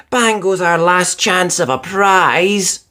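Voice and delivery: slow, drawn-out groan of dismay; slow despairing moan. A narrator's reading. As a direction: let the hope sink out of it. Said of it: "groaned Judy".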